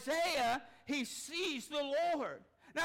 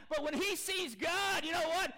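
ordinary delivery

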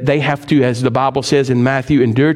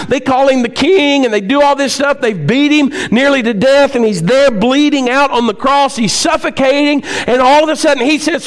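higher, louder preaching, driving each point home; fired-up sermon, voice raised high; full-force preaching; 11 semitones higher, 3 dB louder than usual. Acted. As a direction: preach it with rising fire to the church.

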